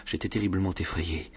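ordinary delivery, nothing marked

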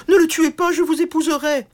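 high, loud begging voice; frantic princess pleading. Acted. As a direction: plead to save him. Princess begs for his life.

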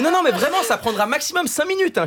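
high pleading caller voice; insistent pollster. Retold or imitated. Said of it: "Elle m'a dit".

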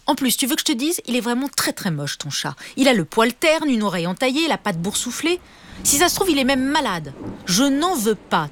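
high, sharp scolding mother's voice; a cross mother telling off her son; angry mother. A narrator's reading. Mother scolds Léo about the stray cat, high and angry. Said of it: "De plus en plus énervée".

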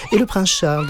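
high, insistent schoolgirl questioning; eager little girl. A narrator's reading. Classmate quizzes the supposed English boy, eager. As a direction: pipe up high and keen.

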